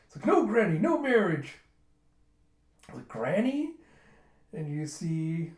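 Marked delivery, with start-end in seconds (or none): 0.3-1.5 high, loud forbidding yell; mock alarmed objection. Objecting to granny's marriage proposal, mock alarm. Objection to marrying drunk captain. 3.1-3.7 high, rising incredulous echo; mock offended disbelief. Woman called granny, offended disbelief.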